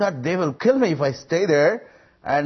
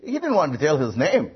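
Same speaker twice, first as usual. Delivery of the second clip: normal talking voice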